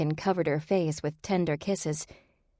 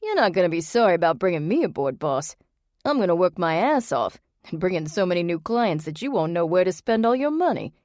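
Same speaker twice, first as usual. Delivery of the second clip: loud, fast Jersey bravado; eager Jersey new hire; Jersey accent; 4 dB louder than usual. A narrator's reading. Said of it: "in his thick Jersey accent".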